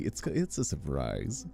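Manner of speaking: usual speaking voice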